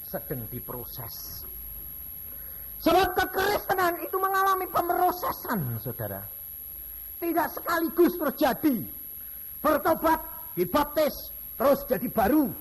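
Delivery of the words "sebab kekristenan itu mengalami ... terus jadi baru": high, loud sermon build; preaching. Acted. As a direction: preach it out to the congregation. Preaching at full force. (khotbah dengan suara tinggi dan keras)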